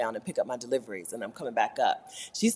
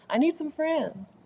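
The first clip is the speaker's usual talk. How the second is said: plaintive; 11 semitones higher, 4 dB quieter, slower than usual. Real, not acted. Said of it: "pleading to viewers".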